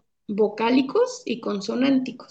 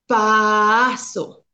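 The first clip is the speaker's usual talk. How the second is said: "paso" with its sounds stretched out long; prolonged stutter; 2 semitones lower than usual. Shown as a demonstration. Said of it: "Es la misma palabra con distinta característica".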